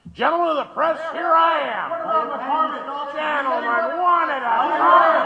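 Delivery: a showman greeting the press pack; calling out to reporters. Acted. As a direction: hail the room, then hold them back one at a time. Mayor calls out to the reporters.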